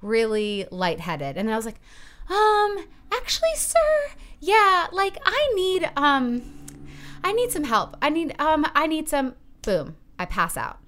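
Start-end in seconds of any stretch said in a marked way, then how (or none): 2.3-9.3 much higher, louder woozy plea for help; faint panic. Retold or imitated. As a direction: sound like you're about to pass out. About to faint in store.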